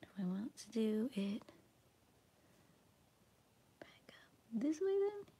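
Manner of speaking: soft ASMR whisper. Real, not acted. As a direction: whisper it close to the mic.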